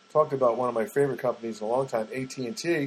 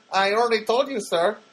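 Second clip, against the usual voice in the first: higher, louder imitated Filipino accent; 8 semitones higher, 4 dB louder than usual. Agent answering a nosy caller, Filipino accent. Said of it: "I detect an accent".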